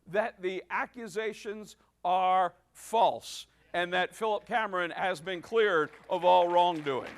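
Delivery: triumphant announcement. Real, not acted. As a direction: build it up and land the good news. Announcing the pastor was cleared.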